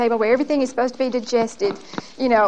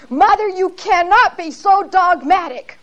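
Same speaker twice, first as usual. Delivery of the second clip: slow, high, louder mock scream; retold scream; 7 semitones higher, 4 dB louder than usual. Daughter screams at her mother at dinner, mock scream. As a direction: echo the daughter's scream, but not full force. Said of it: "she just screamed it out".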